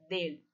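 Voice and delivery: "del" with the tongue touching the roof of the mouth on the l; tongue on palate. Shown as a demonstration. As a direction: end "del" on a clear, held l. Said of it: "ó, língua lá no céu da boca". ("del" com a língua no céu da boca)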